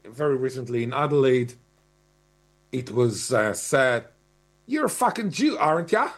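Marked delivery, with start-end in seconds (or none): 4.7-6.1 broad Australian bogan jeer, pitched up; bogan accent. Retold or imitated. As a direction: sneer it like an Aussie bogan yelling from a car. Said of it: "this kind of bogan accent".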